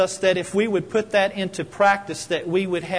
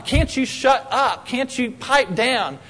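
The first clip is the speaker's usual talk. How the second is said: mock angry scolding; 5 semitones higher, slower than usual. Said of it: "she just went on and on".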